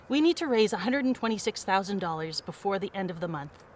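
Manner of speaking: ordinary delivery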